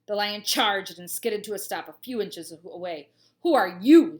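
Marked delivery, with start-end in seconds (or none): 3.4-4.2 loud lion's yell. A narrator's reading. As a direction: bellow the question at him. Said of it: "the lion yelled at my father".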